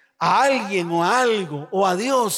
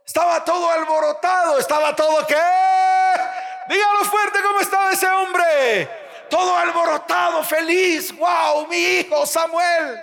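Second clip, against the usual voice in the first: fervent preaching; 8 semitones higher than usual. Acted. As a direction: preach it to the crowd with full force. (predicación fervorosa, voz alzada)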